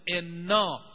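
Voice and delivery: solemn reciter's chant; Quranic recitation. Shown as a demonstration. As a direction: chant each word drawn out. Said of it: "خدای تبارک و تعالی قول داده".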